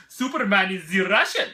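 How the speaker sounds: loud hammed-up Russian accent; bad Russian accent. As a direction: boom it out in a terrible mock Russian accent. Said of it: "That was probably a terrible accent".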